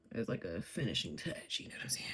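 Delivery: breathy confiding whisper; whispered tip. Real, not acted. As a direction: lean in and whisper. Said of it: "makeup tip whispered".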